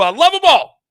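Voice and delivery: high and loud, full of love for the crew; excited shout-out. Real, not acted. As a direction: belt it out to the whole crew.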